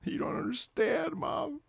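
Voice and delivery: hammed-up helpless protest; mock whiny plea. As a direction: whine it like a thwarted kid. Acts out pleading with mom.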